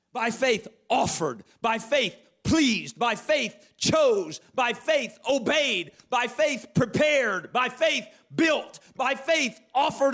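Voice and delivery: each verb ("offered", "pleased", "chose", "built") punched and slowed, falling; stress on the verbs. Shown as a demonstration. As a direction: list them one by one, hitting each verb. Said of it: "By faith was always followed by a verb".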